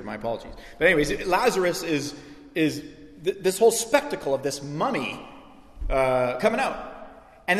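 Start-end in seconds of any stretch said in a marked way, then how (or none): none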